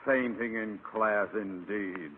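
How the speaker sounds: low, slow sneer fading down; scornful scoff. Acted. Principal scoffing at fainting student, low slow sneer. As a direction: sneer at the excuse.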